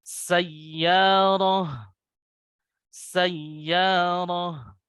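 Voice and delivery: sayyarah with the doubled ya pressed; clear doubling. Shown as a demonstration. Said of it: "itunya harus jelas ya".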